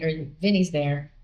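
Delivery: ordinary talk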